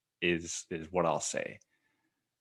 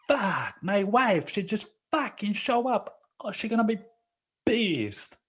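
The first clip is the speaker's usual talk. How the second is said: mock panic, pitched way up; imitating panicked boss; 13 semitones higher than usual. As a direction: squeak it out like a scared boss. Boss panicking about his wife.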